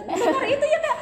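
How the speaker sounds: high, loud words through laughter; laughing. Real, not acted. Guest laughing while joking about loud Batak speakers, giggling.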